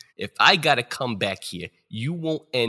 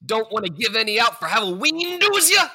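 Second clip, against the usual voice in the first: taunting; 12 semitones higher, 5 dB louder, faster than usual. Acted. Spirit taunting while beating.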